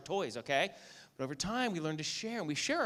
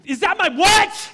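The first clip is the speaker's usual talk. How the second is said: sudden much higher, much louder, slower outburst; shocked shout; 18 dB louder, slower than usual. Retold or imitated. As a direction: break off mid-announcement in alarm. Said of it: "New Julie was scaring me".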